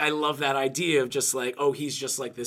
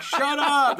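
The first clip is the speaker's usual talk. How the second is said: mock yell; 12 semitones higher, 5 dB louder than usual. Someone yells in retold scene.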